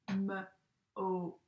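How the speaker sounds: segmenting m-ope into sounds; sounded out. Shown as a demonstration. Teacher sounding out a word for her class. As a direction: stretch m-ope apart, sound by sound. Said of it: "it now says".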